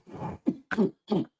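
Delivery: short rough throat-clearing cough; dry cough. Real, not acted. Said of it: "my throat itching".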